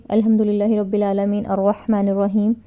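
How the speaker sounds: fast, rattled-off Quran verse; rushed recitation. Shown as a demonstration. Teacher showing the wrong, hurried way to recite: rushed and run together. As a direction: gabble the words as if in a hurry. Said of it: "جیسے کچھ لوگ بڑی تیز پڑھتے ہیں".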